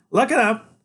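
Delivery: put-on small boy waking his big friend for a quest; eager boy genius. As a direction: rouse him like a tiny herald. Freak voiced.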